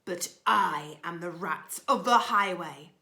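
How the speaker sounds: a lightly voiced swaggering rat; a hint of a menacing robber; gruff highway rat. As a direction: boast it like a bandit rat. Reading The Highway Rat to listeners.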